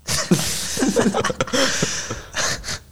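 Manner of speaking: high, airy giggling; real laughter. Real, not acted. Friends hear the Stroganoff pun, real giggling. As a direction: giggle away at a bad pun.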